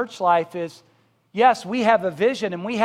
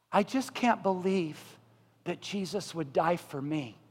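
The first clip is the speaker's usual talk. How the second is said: softer, slow and hushed; awed little girl; 8 dB quieter than usual. Retold or imitated. Pastor voices girl amazed Jesus died for her, hushed and slow. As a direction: let it fall away in quiet wonder. Little girl's tearful confession retold.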